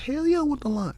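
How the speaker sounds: high-pitched thrilled acceptance; eager yes. Retold or imitated. Rookie actor accepting a line from the director, excited. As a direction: jump at the offer in a high voice. Retells jumping at a movie line.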